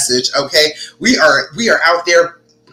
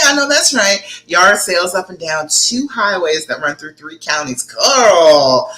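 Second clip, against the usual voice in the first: normal talking voice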